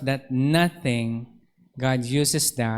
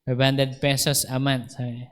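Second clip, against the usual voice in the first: ordinary talk, nothing marked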